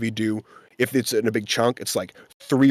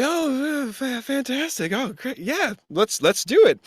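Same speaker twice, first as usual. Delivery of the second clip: forced composure; 14 semitones higher, slower than usual. Retold or imitated. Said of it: "But, uh, I just was like".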